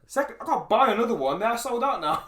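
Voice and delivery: high, loud mock panic; retold exasperation. Gamer berating himself over his Xbox, exasperated. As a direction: reenact your own dismay, pitched right up.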